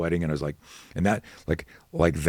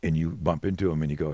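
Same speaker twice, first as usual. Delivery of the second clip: ordinary talk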